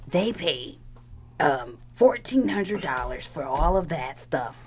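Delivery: slow, soft mock of the complaining coworker; lightly voiced whiny coworker, drawn out; imitating coworker. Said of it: "And he was like".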